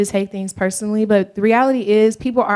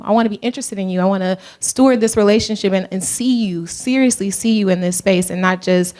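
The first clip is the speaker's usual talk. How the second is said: ordinary talk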